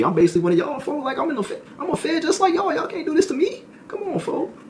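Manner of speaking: pleading in a very high voice. Retold or imitated. To the officers, a high-pitched mock plea.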